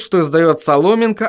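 usual speaking voice

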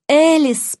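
high, drawn call of a name; high anguished cry; moaned cry. A narrator's reading. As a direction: call out with a pained moan. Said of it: "простонала я".

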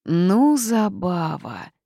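loud start, long drawn-out name; reproachful. A narrator's reading. Forest spirit scolds the girl, reproachful drawl. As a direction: chide her, stretching the words slowly. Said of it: "укоризненно посмотрел на меня".

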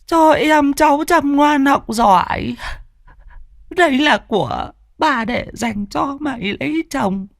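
put-on very high, slow, wailing old grandmother; keen over the body like a grieving granny; grandmother's lament. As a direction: grandmother mourning her dead granddaughter, high slow wail. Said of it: "chắp tay lên ngực lẩm bẩm".

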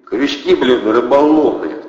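loud, slow mocking jab; sarcastic quip. A narrator's reading. Protasov mocking Andrey's question, sarcastic. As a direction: toss it off with sarcasm. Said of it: "сострил Протасов".

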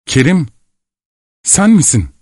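high-pitched exclamation, understated scream; cry of surprise. A narrator's reading. Cemile spots Kerim the soldier, a high surprised cry. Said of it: "Cemile bir çığlık attı".

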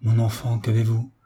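plain reading voice

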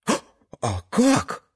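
put-on high, slow stammering kid; eager child voice. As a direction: ask it like an excited small boy. Son asks eagerly.

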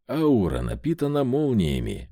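plain reading voice

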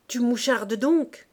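loud, slow, biting accusation; furious cry. A narrator's reading. Sister rounding on Claire, loud slow fury. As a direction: hurl the accusation at her sister. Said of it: "cria-t-elle".

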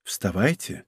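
soft, low and affectionate order; tender command. A narrator's reading. Aramis rousing the giant from sleep, gently commanding. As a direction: wake someone gently but firmly. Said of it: "Голос Арамиса был нежен и ласков".

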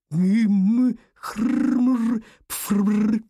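wordless high muffled mumble; smothered, garbled sounds; muffled mumbling. A narrator's reading. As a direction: mumble through hands clamped over the mouth. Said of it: "прижал руки ко рту и пробормотал".

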